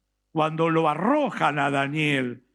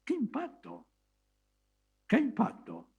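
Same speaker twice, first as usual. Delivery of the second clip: much higher, breathy-soft amazement; awed exclamation; 11 semitones higher, 7 dB quieter than usual. Real, not acted. Preacher marvels to the congregation, high and hushed.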